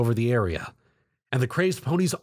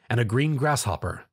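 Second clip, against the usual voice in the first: ordinary delivery, nothing marked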